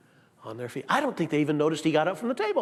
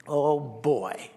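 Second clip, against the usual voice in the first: ordinary talk, nothing marked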